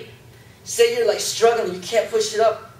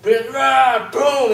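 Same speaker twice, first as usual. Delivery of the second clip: louder and higher, a hammed-up grunt of slamming the weights; mock slam yell; 7 semitones higher, 7 dB louder, slower than usual. Shown as a demonstration. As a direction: bark it like a showoff dropping the bar. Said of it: "You gotta be like".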